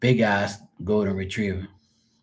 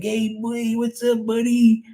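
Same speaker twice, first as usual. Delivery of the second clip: high sing-song voice for a dog; excited high baby talk to a dog; high pet-talk coo; 13 semitones higher, slower than usual. Retold or imitated. Greeting the dog.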